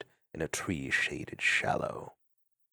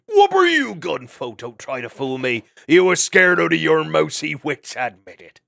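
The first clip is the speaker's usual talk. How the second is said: teasing otter shout; 7 semitones higher, 10 dB louder than usual. Acted.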